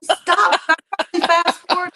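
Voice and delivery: loud disbelieving stop; shocked shout. Real, not acted. Cohost stunned by the drunk gossip, loud shout. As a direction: cut her off in shock.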